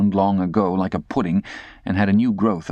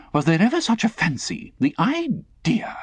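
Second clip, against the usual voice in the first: high, indignant lady; 14 semitones higher than usual. Acted. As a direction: huff it out indignantly. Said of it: "with indignation".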